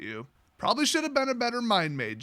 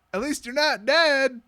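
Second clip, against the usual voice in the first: higher, slow, drawn-out mock comfort; mock consolation; 6 semitones higher, 3 dB louder than usual. Reader interrupts author with consolation, mock comforting. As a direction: stretch out the hollow consolation. Throws own joke into story.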